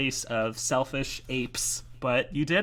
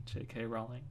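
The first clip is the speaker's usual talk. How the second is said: ordinary delivery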